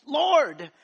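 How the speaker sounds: high, loud, desperate cry to God; a husband screaming for help as his wife stops breathing; screamed prayer. Retold or imitated. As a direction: scream the plea like a drowning man. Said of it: "I was screaming like".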